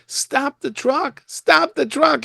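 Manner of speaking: high, slow, stretched yell; yelling it out, drawn long and high; retold scream. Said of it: "he's screaming at me".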